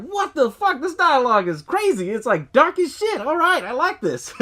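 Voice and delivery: high, loud, excited disbelief that slows down; stunned, then delighted by the dark line; amazed reaction. Retold or imitated. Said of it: "And I was just like".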